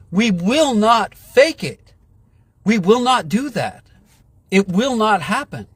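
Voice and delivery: defiant, hammering each refusal home; real anger. Real, not acted. Vowing to viewers he won't fake it, angry. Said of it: "I'm so fucking mad".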